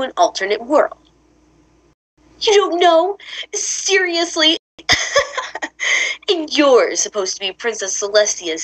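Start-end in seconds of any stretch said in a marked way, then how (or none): 2.4-4.6 high, incredulous taunt; mocking disbelief. Villain quizzing the rival who has no answer, mocking. Villain mocks heroine's ignorance. 4.9-6.2 short, voiceless mocking chuckle; scornful laugh. Villain laughing at Princess Celestia's star student, scornful.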